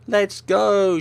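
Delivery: high, loud eager shout; excited. Acted. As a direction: cheer it out. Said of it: "Shazeo is excited".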